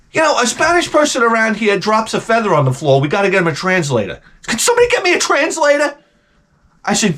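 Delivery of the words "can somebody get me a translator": high-pitched mock yell for help; retold yelling. Man calling the house for a translator, mock yelling. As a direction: imitate an exasperated call to the whole room. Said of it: "no reaction to my yelling".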